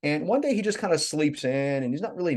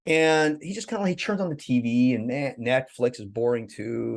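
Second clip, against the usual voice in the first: ordinary talk, nothing marked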